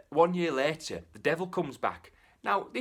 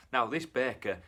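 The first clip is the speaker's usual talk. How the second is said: usual speaking voice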